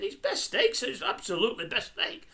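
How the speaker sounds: high-pitched chef voice, proud of his steak; chef insisting. Retold or imitated. Chef defending his steak to Hector, high mock voice. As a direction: squeak out the chef's indignant defence. Voicing the chef in retold scene.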